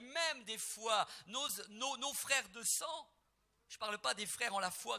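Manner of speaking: loud, high, ringing pulpit voice; preaching at full force. Acted. Preacher to congregation, declaiming loudly and high. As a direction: proclaim it to the whole church.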